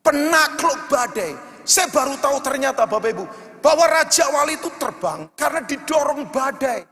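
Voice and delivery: loud, fervent sermon over music; full-force preaching. Acted. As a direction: preach it out, pushing the congregation. Preaching climax to amen call.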